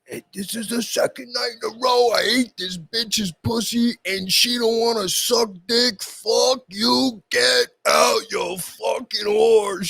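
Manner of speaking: high, loud raging scream. Retold or imitated. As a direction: bellow at her, furious. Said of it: "Screaming at her".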